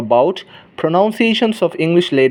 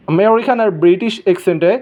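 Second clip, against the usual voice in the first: ordinary delivery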